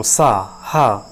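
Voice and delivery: slowly drawn-out सा and हा, air rushing over the tongue; slow, low friction sounds for the class; hissing friction demo. Shown as a demonstration. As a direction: stretch सा, हा slowly so the friction is heard. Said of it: "सा में जीभ में हवा का घर्षण".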